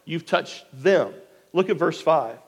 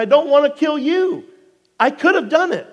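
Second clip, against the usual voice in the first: high-pitched, emphatic appeal; calling out; 8 semitones higher, 5 dB louder than usual. Acted. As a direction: plead your innocence in a raised voice. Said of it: "he calls out to Saul".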